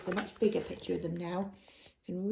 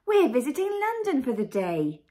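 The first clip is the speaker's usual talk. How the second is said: put-on higher, louder little-child voice; Peppa; 4 semitones higher, 7 dB louder than usual. A narrator's reading. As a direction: chirp it up to the queen. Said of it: "said Peppa".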